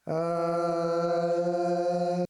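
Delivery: sung note through detuned chorus; wobbly detuned layered voice; detuned group effect. Shown as a demonstration. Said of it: "that's like a detuned group".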